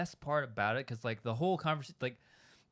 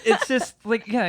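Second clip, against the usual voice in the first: ordinary delivery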